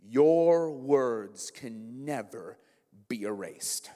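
very slow, low, weighty delivery; grave pronouncement. Retold or imitated. College president gives students his lesson, slow and grave. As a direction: let each word land, drawn out and low.

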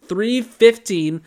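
high, loud, slow insistence; exasperated insistence. Real, not acted. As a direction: stubbornly correct the time. Correcting friend's time again.

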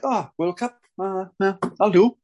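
bored shrug. Retold or imitated. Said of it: "you're just like".

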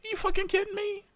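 high, scoffing disbelief; high incredulous retort. Retold or imitated. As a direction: brush the cop off with mock outrage. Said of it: "I'm like".

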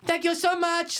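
high airy thank-you. Retold or imitated. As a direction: mimic a gushing, grateful winner. Keys quoted accepting award.

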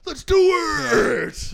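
high, loud, drawn-out cheer; excited shout. Pretend. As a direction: yell it like a pep rally. Mock enthusiastic suicide cheer.

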